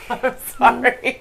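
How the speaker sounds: high, giggly apology through laughter; can't stop laughing while apologizing. Real, not acted. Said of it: "cannot help why I'm laughing so hard".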